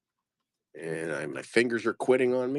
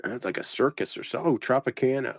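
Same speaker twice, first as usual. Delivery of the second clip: ordinary delivery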